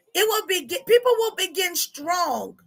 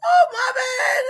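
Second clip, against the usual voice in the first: laughing apology; 7 semitones higher, 3 dB louder, slower than usual. Real, not acted. Still laughing after fall.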